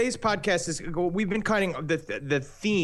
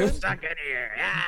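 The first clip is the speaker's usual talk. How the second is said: mock trapped-prisoner cameo; put-on voice ending in a cry; Charles Manson. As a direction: play a caged crazy man. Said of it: "Charlie even got a little cameo".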